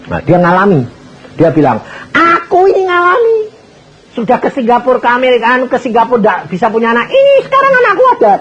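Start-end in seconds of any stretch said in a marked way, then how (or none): 2.1-8.3 high, excited voice, racing at the end; bursting with joy over the child; excited testimony. Acted. Grateful businessman testifies about his child.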